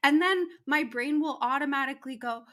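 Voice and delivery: normal talking voice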